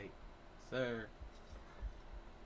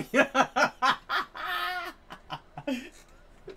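high crying laughter; 13 semitones higher, 7 dB louder than usual. Real, not acted. Laughing at teammate's accent.

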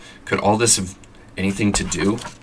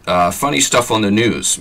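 plain reading voice

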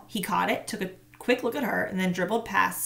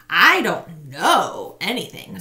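put-on stubborn boy; 7 dB louder, slower than usual. A narrator's reading. Marshall sullen, slow.

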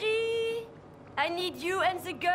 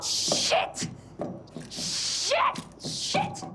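pained cursing; 10 semitones higher, much slower than usual. Acted. Stabbed, cursing in pain.

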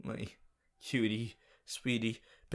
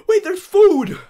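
high, loud gasp of joy; thrilled discovery shout; excited shout; 16 dB louder than usual. Real, not acted. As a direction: burst out at finding food. Gasps, finds chocolate.

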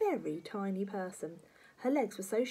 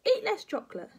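very high, loud granny order; pitched-up nagging command; bossy grandma; 7 dB louder than usual. Acted. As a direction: lay down the law.

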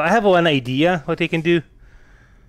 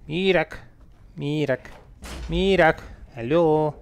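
usual speaking voice

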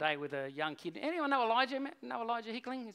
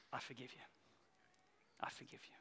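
hushed, low, almost whispered; tender forgiveness; 7 semitones lower, 19 dB quieter than usual. Retold or imitated. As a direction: let it sink to a whisper of mercy. Father tenderly forgives his son.

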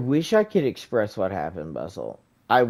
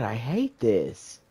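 usual speaking voice

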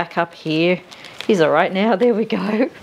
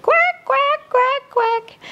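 very high squeaky duck quacks; much slower than usual. Acted. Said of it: "Mother duck said".

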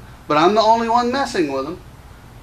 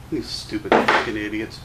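muttered insult; 9 semitones lower, 3 dB quieter than usual. Real, not acted. Insulting gun critics.

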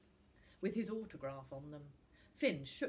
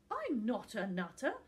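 hotly; 4 semitones higher, 6 dB louder than usual. A narrator's reading. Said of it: "burst out Finn hotly".

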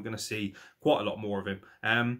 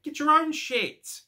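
much higher, louder, slow exasperated shout; exasperated yell; 5 dB louder, slower than usual. Real, not acted. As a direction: bellow it out in disbelief. Exasperated rant at Young Bucks.

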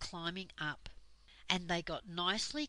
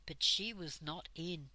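ordinary delivery

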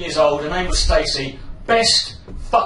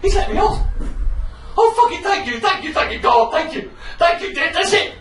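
elated relief; 11 semitones higher than usual. Retold or imitated. Relief after pain vanishes.

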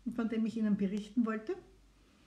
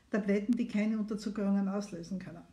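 ordinary talk

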